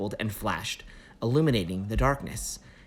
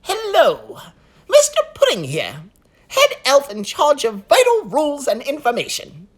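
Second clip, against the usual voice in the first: high, loud nervous elf; fretting little elf official; fussy elf voice; 10 dB louder than usual. Acted. Elf character voice.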